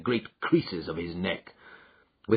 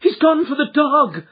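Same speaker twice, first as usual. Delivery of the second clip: panicked cry, much higher and louder; cried out in alarm; 9 dB louder than usual. Acted. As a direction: warn them in terror. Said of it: "cried Miss Hunter".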